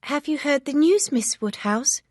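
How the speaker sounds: cried out. A narrator's reading. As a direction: burst in with the news. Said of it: "she cried".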